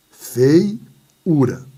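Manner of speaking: feiura broken into fei, ura; syllable split. Shown as a demonstration. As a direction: pause after "fei" in feiura. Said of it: "tá aqui minha sílaba".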